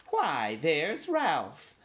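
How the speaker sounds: high, drawn-out squeak; grand mouse aunt, high and stretched out; squeaky aunt. A narrator's reading. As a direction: squeal a very slow greeting. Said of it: "squeaked his Aunt Sissy".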